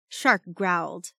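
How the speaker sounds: ordinary delivery, nothing marked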